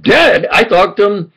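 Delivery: high, stunned echo of dead; disbelieving gasp of a word; stunned question on hearing of a death; shocked. Real, not acted.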